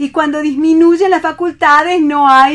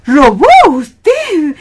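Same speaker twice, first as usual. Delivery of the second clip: louder, slow and drawn out in disbelief; can't believe he stole for her; thrilled shock at his crime; shocked gasp; 5 semitones higher, 11 dB louder, much slower than usual. Acted. Singer shocked at the theft.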